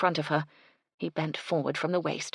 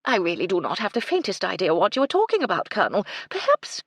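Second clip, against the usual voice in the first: quick, louder protest, cut off high; exasperated; 4 semitones higher, 5 dB louder, faster than usual. A narrator's reading. Lady lost by colonel's talk, quick exasperation. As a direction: protest briskly, then break off mid-thought.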